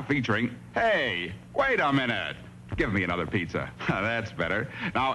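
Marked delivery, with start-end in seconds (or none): none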